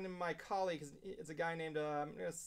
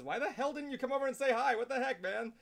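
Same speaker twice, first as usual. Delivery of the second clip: higher, louder mock protest; mock outraged call-out; 7 semitones higher, 3 dB louder than usual. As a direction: play the friend's fake offense, a bit raised. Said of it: "the first thing he says is".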